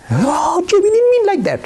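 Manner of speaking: very high, flustered protest; shocked protest. Retold or imitated. Flustered minister protesting to Jay, in a very high voice. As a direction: squeal it out, caught off guard. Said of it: "minister protesting in shock".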